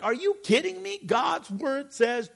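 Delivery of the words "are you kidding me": shoots way up in disbelief; are you kidding me, high and sharp; mock astonishment; incredulous. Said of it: "And I'm like".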